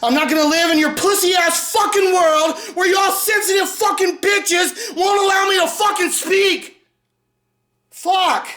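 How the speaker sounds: high, raging yell; furious shout. Real, not acted. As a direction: defy them at full volume. Ranting at critics.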